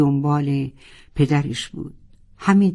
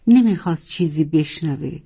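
ordinary delivery

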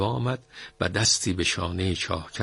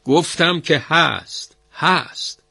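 higher, slow, loud insistence; shouting; 8 semitones higher, 4 dB louder, slower than usual. Acted. Master shouts at the well-digger to keep digging. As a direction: hammer it home, raised and slow. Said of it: "ارباب با صدای بلند فریاد زد".

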